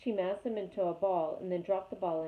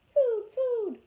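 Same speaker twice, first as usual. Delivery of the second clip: high, louder squeaky chick demanding food; hungry gosling; 8 dB louder than usual. A narrator's reading. Gosling voice held.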